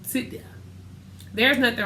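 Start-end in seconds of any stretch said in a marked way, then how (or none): 0.0-0.4 soft, hushed be quiet; a hushed instruction to stay still; quiet command. Retold or imitated. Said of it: "He said".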